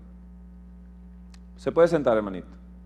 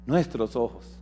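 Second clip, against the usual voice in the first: soft echo; 6 semitones lower, 10 dB quieter than usual. Acted. Echo of quote.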